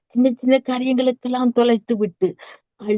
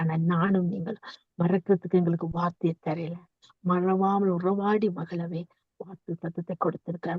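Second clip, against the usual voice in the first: softer, lower, consoling voice; tender reassurance; 3 semitones lower, 7 dB quieter than usual. Real, not acted. Preacher tells the weeping not to cry, tender reassurance. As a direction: gently hush someone who is crying.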